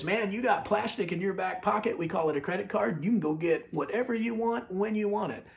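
put-on pitch for buying on credit; mock American pitchman. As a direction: play the pitchman selling instant spending. Voicing the American way's sales pitch.

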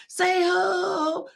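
high chanted crowd response. Retold or imitated. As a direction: answer like the whole crowd. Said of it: "they like".

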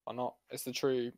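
ordinary talk, nothing marked

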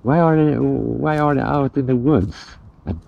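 ordinary talk

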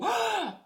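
high, sudden gasp; sharp excited gasp. Retold or imitated. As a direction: gasp like you've spotted someone. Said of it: "sometimes if people go".

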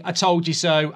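ordinary talk, nothing marked

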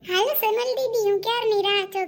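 high-pitched coaxing call; calling along. Acted. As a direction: call the sister-in-law to come along. Sister-in-law calls Sonal to come along. (ઊંચા સાદે બોલાવવું)

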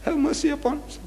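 high, softly wailed plea to God; imitated tearful praying; groaned prayer. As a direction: plead for mercy in a moaning high voice. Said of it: "He's talking to Allah".

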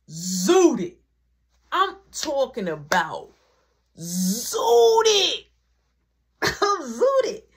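zooted drawn out long, then high and laughing; stretched out zooted. Real, not acted. Telling friends how high he got, stretching zooted. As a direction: lean hard on zooted, stretching it. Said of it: "So I'm sitting there".

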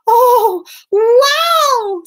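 high, loud cat wow; big, high storybook amazement; amazed Puss. Acted. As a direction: exclaim wide-eyed in a high cat voice. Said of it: "said Puss".

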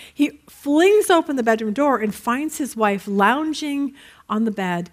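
ordinary talk, nothing marked

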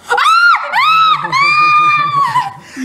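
high, loud shrieking laugh. Real, not acted. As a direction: burst into a screaming laugh. Reacts to spoiled milk.

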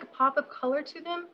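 ordinary talk, nothing marked

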